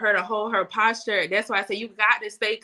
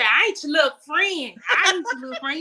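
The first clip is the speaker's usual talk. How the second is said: imitating mom; 4 semitones higher than usual. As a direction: play the mother setting you straight. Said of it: "she used to say".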